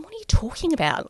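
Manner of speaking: high, baffled question; husband caught off guard; husband confused by the panic; confused disbelief. Retold or imitated. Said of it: "he's going".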